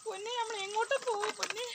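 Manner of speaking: ordinary talk, nothing marked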